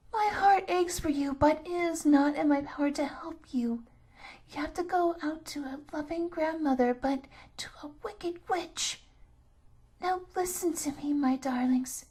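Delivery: put-on an old woman who aches for the children; pitying old grandmother. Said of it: "pitying the children".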